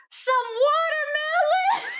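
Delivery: high, loud, slow shouted call; retold yell. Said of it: "and she yells".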